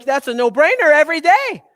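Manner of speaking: loud, bright-eyed mock expert; an enthusiastic expert's loud no-brainer; upbeat quote. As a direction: toss it off as if it's obvious, loudly. Voicing the movement expert's answer.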